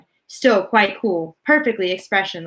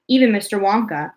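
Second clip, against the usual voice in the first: ordinary delivery, nothing marked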